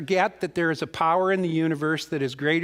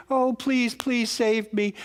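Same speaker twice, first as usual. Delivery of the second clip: mock damsel scream; 6 semitones higher, slower than usual. Tess begs Dudley to save her from the rails, mock damsel scream. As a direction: plead like a melodrama damsel, drawing out the end. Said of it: "And Tess is screaming".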